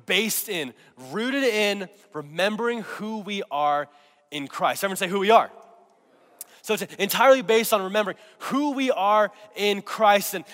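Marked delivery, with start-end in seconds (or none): 5.1-5.5 call-and-response. Real, not acted.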